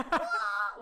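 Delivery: short high-pitched kick yell; mock battle yell. Kid leaping to kick, mock yell. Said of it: "he goes".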